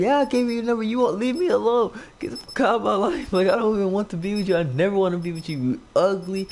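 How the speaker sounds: high-pitched, hurried mock rant; retold telling-off. Telling the girl to leave him alone, high mock rant.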